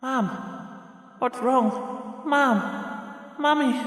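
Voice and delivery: put-on a little girl terrified for her mother; frightened child. Child sees mother collapse.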